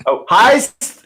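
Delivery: high, loud delighted cry; excited exclaim. Real, not acted. Player spotting a heist note, loud and excited. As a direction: jump on the word with glee.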